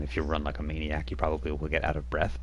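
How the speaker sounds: ordinary delivery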